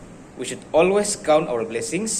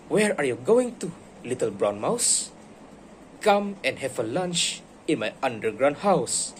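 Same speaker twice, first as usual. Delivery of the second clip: put-on lively read-aloud for kids; animated bedtime story voice; storybook reading. A narrator's reading. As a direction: read it like a picture book to a class. Teacher reads The Gruffalo aloud.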